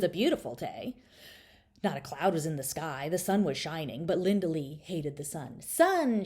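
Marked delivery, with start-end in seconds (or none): 5.6-6.2 high, loud yell at the sky; angry little girl yelling. A narrator's reading. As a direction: shout it up at the sun. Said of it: "she yelled".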